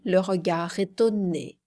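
ordinary delivery, nothing marked

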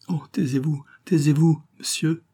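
frightened. A narrator's reading. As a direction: beg him to be silent, in fright. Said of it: "fit la baronne avec effroi".

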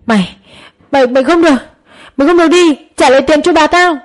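screaming. A narrator's reading. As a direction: scream while clinging to the thief. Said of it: "mồm gào thét". (gào thét tuyệt vọng, giọng cao)

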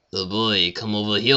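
cartoonish, badly faked French accent; hammed-up French accent, like a cartoon character. Shown as a demonstration. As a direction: loudly fake an awful French accent. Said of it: "Except in a French accent".

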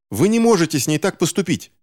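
put-on high, urgent girl's protest. A narrator's reading. Girl protests to the villain. (горячий высокий протест)

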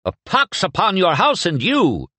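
high, heated shouted curse; angry curse. Acted. Rival swordsman curses his enemy, heated shout. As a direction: hurl the curse across the garden. Brawling young men curse each other.

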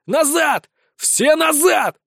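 shouting. Acted. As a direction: yell at the soldiers to pull back. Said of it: "кричу".

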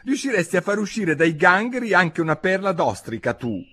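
high-pitched testy complaint; vexed young voice; peeved. A narrator's reading. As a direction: gripe back at a nagging mother. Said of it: "disse la gamberina con una certa stizza".

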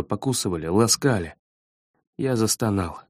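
ordinary delivery

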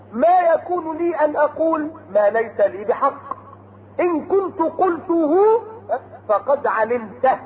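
slow chanted verse; Quran recitation. Acted. Preacher reciting Noah's words to his people, chanted. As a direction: intone it like a reciter.

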